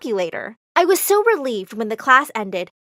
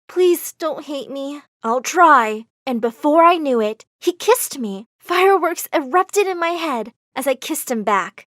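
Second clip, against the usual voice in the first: ordinary delivery, nothing marked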